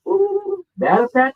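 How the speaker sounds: high, excited ooh of surprise; surprised. Retold or imitated. A tattoo artist, amazed his client is Apache, surprised. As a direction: pitch it way up, amazed.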